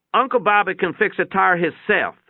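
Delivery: put-on a loyal kid speaking up, pitched high; eager boy. A narrator's reading. Boy proudly defends uncle.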